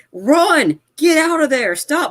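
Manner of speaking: high-pitched mock yell at the TV; urgent imitation of screaming at a film; retold screaming. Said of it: "I was screaming on my T V".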